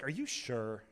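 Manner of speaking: lightly mocked fan, soft and low; imitated girls' voice. Second girl doubting the star's denial, lightly mocked and hushed. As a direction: put on a hint of a pestering fan girl. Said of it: "they had that voice".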